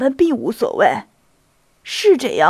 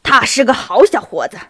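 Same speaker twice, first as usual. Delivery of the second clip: loud, hearty vouching; raised voice, standing up for him; calling out; 7 dB louder than usual. A narrator's reading. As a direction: exclaim it with force. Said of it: "杰尼索夫喊道".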